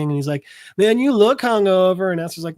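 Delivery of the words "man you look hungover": higher, louder tipsy voice; lightly voiced drunk pal; drunk guy. Drunk friend teasing his buddy, lightly tipsy. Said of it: "dude's still fucking drunk".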